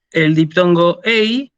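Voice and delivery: slow, clear "ei" gliding e into i; ei as two vowels. Shown as a demonstration. Teacher modelling "ei" for the students. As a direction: say "ei" as e then i, deliberately. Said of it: "se pronuncia como ei".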